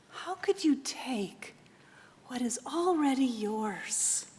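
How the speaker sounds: mother gently comforting her son; tender mother. Acted. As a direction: hush a frightened child.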